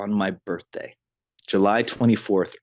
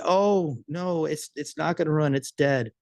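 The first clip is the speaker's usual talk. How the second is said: high-pitched imitation of a worried customer; mildly dismayed protest, pitched up; 7 semitones higher, slower than usual. Customer protesting to the call taker, imitated worry.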